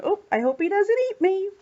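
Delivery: high, quick anxious little voice; worried. Acted. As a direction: fret like a nervous child passing a lion, played lightly. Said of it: "he might be a little bit worried".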